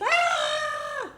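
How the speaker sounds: put-on high shrieking cry of scared kids; mock scream. Librarian voicing the fleeing kids, high mock scream.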